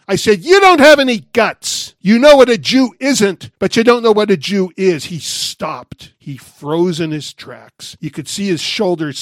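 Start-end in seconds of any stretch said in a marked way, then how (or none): 0.4-5.0 loud, high, cutting yell at a man walking away; a challenge hurled at someone's back; shouting after him. Retold or imitated. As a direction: call him out, loud and sharp. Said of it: "I finally shouted after him".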